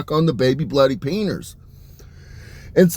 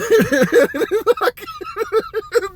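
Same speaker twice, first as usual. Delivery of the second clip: squealing laugh. Real, not acted. Laughing fit at own story.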